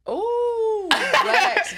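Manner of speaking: high laughing exclaim. Real, not acted. Host laughing at the family story, high laughing exclaim. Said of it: "laughs Yeah".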